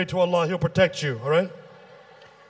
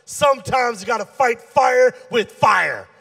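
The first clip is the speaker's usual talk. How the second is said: louder, slow and insistent; emphatic repeat; 3 semitones higher, 5 dB louder than usual. Acted. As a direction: hammer each word of the saying. Saying repeated louder after "What?.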